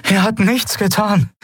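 high-pitched urgent protest; urgent plea. A narrator's reading. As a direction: plead high to stop him. Heroine pleads to stop a shooting. (hohes, dringendes Flehen)

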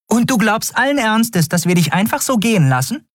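very high, incredulous challenge; indignant fairy companion. Acted. Companion refusing to let captain go alone, indignant. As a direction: challenge her in a high, small voice.

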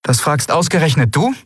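high, pointed retort; sharp. A narrator's reading. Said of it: "Es klang schärfer, als sie beabsichtigt". (scharf und vorwurfsvoll)

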